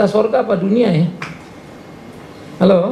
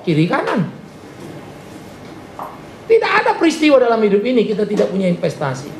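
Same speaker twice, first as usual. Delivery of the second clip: usual speaking voice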